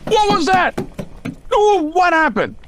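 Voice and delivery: jolted awake, alarmed; startled. Acted. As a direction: snap to, startled. Startled by gunshot.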